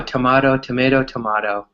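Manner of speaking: tomato said first as to-MAY-to, then to-MAH-to; both pronunciations. Shown as a demonstration. Teacher demonstrating the tomato joke with two vowels. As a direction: swap the vowel: tomayto, then tomahto. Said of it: "the joke is".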